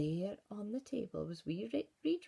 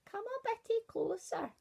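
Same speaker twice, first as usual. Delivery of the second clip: wolf coaxing. Acted. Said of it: "said the wolf".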